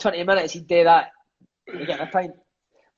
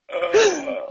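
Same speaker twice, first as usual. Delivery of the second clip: short real laugh, pitched up; high burst of laughter; 11 semitones higher than usual. Real, not acted. Mate telling the Merson story, cracking up laughing. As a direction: laugh at the punchline.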